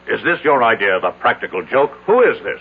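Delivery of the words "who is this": startled. Acted. Boss startled by strange visitor.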